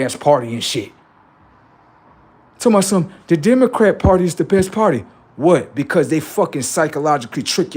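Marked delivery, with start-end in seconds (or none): 2.6-5.0 mock Democrat loyalist. Mocking a Democrat supporter.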